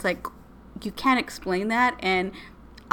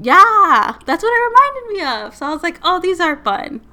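excited; 11 semitones higher, 11 dB louder than usual. Real, not acted. Excited reaction to recognizing podcast.

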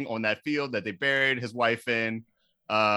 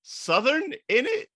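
surprised; 14 semitones higher than usual. Retold or imitated. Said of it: "And I was like".

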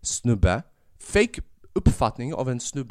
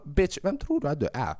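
sassy mock challenge; 6 semitones higher than usual. Mocking the show's episode zero.

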